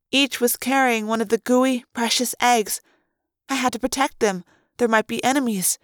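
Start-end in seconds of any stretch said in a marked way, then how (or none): none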